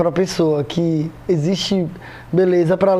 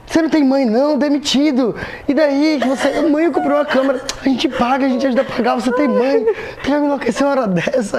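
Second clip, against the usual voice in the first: scolding mother; 7 semitones higher than usual. Retold or imitated. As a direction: play the mother scolding her son. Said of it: "Aí ela começou a brigar comigo".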